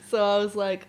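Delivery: usual speaking voice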